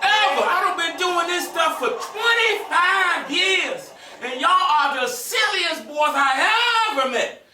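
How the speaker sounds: high, slow mock scolding teacher; exasperated teacher. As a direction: drag it out like a fed-up teacher. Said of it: "She's like".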